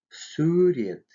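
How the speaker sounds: "сурет" with a soft, fronted у; soft vowel. Shown as a demonstration. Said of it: "в мягких мягко". (мягкое «у» в слове «сурет»)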